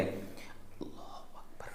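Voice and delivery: slow whispered takbir, lips just moving; whispered prayer demo, slow and soft; barely audible whisper. Shown as a demonstration. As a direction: breathe it out softly so only the lips move. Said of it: "इस तरीके से बोलें कि हमारे होंठ हरकत करें".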